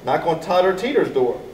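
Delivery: usual speaking voice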